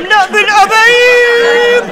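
high, loud and slow, dragging out the threat; mock threat. Sister threatens her brother in a loud, slow mock menace. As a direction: stretch each word like a comic menace.

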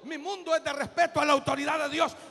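booming declaration of loyalty; declaring from the pulpit. Acted. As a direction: proclaim it with full force. Declares his stance loudly.

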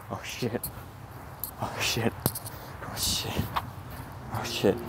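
under-the-breath oh shit, over and over; hushed swearing. Real, not acted. Sneaking vlogger, hushed and tense. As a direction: mutter it, afraid to be heard.

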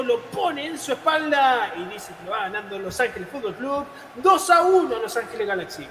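normal talking voice

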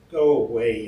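loud yell at an unchanged pitch; yelled warning. A narrator's reading. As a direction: yell it to drive him off. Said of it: "yelled Roberts".

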